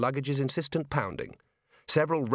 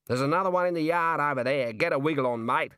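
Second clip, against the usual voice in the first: gruff watchman; 3 semitones higher than usual. Acted. Watchman gives an order.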